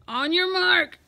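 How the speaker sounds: loud, high starter's call; bellowing. A narrator's reading. Coach starting the swimmers, bellowing. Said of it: "bellowed the coach".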